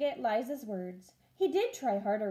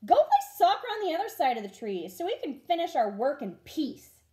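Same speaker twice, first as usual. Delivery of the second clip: a fast, louder shout, not full force; 5 semitones higher, 5 dB louder than usual. Acted. Busy rabbits snap at Ralph to go play elsewhere, fast and loud. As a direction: hurry him off with a brisk raised voice. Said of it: "The rabbits shouted at Ralph".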